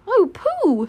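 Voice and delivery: delighted exclamation. Acted. Christopher Robin to Pooh on seeing bees, delighted. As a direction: exclaim to a friend, pitched right up.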